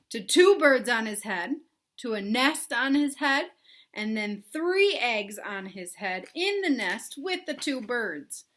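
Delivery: plain reading voice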